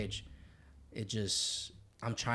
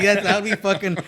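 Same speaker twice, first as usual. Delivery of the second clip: ordinary talk, nothing marked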